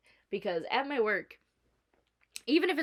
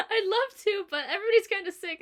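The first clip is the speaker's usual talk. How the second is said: higher, quick laughing apology; laughing regret; 11 semitones higher, 4 dB louder than usual. Retold or imitated. Turning a friend's visit down, higher and laughing. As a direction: giggle the excuse out fast.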